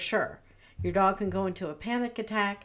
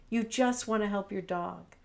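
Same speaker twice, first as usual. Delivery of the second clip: normal talking voice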